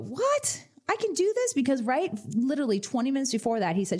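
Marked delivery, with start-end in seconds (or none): 0.0-1.5 high, amazed squeal of disbelief; retelling a thrilled client's high-pitched amazement; astonished. Retold or imitated. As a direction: gasp it out like you can't believe your luck. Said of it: "he went".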